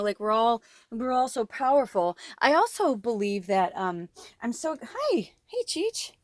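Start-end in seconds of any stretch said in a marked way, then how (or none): none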